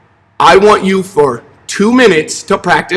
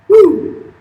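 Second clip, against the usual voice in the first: excited whoop; 13 semitones higher than usual. Real, not acted. Exclaiming during a stretch.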